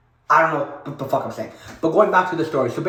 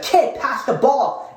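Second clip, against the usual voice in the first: calling out; 7 semitones higher, much slower than usual. Retold or imitated. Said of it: "He yelled at me".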